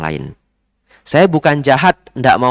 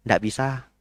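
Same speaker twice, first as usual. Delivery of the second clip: much softer, flat no; quiet smiling no; calm refusal; 9 dB quieter than usual. Shown as a demonstration. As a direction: say no gently, no reasons. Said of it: "Sambil senyum ya".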